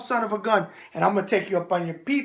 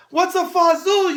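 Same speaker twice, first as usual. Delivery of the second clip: mock viewer question; 8 semitones higher, 5 dB louder than usual. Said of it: "you say".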